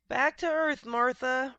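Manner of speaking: loud high yell. A narrator's reading. Rob calling his cow to flee, yelling. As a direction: yell to her as you run. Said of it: "Rob yelled".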